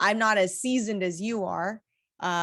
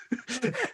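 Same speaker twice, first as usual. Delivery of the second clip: high giggle; 3 dB quieter than usual. Real, not acted. Laughs after hit.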